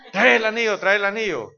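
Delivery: high, loud call after someone; loud, high-pitched retold yell. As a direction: shout after him to bring back the ring. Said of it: "el hermano gritándole".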